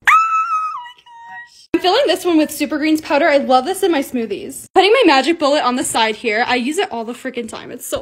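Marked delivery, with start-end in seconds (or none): 0.1-1.7 much higher, drawn-out delighted shriek; slow high thrilled squeal; excited squeal. Real, not acted. Thrilled by the honey jar.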